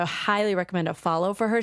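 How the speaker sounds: ordinary talk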